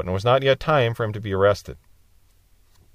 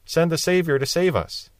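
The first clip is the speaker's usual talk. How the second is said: mock desperate cry; 5 semitones higher, slower than usual. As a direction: act out crying to heaven for rescue. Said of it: "they cried out".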